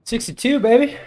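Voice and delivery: excited cheer. Real, not acted. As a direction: celebrate the new score. Celebrating typing score.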